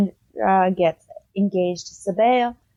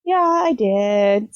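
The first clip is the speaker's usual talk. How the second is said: slow, unbothered admission; 2 semitones higher, 3 dB louder, much slower than usual. Retold or imitated. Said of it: "she goes".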